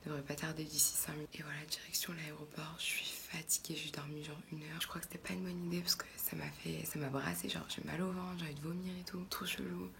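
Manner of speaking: soft unvoiced whisper. Real, not acted. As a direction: whisper so nobody wakes. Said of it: "Je peux pas parler fort parce qu'il est".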